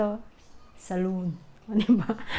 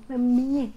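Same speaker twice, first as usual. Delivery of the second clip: Mami, mami called louder and higher with force; stressed call; 4 semitones higher, 7 dB louder than usual. Shown as a demonstration. Vlogger showing how India calls Mami, forceful call. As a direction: hit each Mami hard, like calling out. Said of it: "Talagang may diin".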